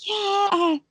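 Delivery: high, wavering frightened voice; high shaky mock fear; scared guest. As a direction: imitate her fright at a spider. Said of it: "She's like".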